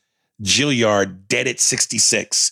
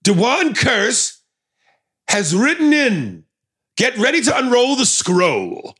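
town crier; 11 semitones higher than usual. Acted. Announcing listener email like herald.

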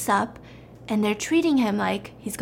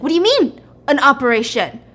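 loud, higher-pitched acted alarm; alarmed protest; 6 semitones higher, 6 dB louder than usual. Patient learning of a surprise operation, acted alarm. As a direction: challenge them in rising panic.

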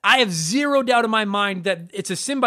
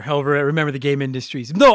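ordinary delivery

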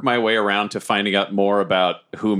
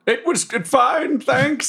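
high, awkward character voice; hammed-up awkward politeness; flustered evasive traveller; slower than usual. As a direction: brush off the question uneasily. Flustered Bobbert brushes off steward.

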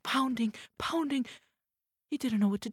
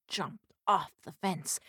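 usual speaking voice

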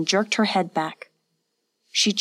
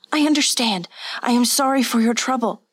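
higher, pleading apology; hurried-to-please servant in fear; frightened stammer; 5 semitones higher than usual. A narrator's reading. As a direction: apologise anxiously in a raised pitch. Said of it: "she stammered".

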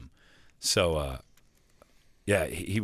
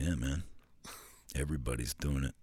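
sheepish Gruden imitation; 5 semitones lower, 7 dB quieter than usual. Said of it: "everyone talks like that".

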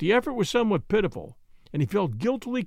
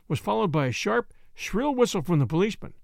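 usual speaking voice

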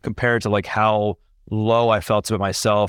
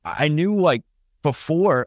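emotional; 6 semitones higher, slower than usual. Real, not acted. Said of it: "I get emotional talking about this".

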